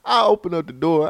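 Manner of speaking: ordinary talk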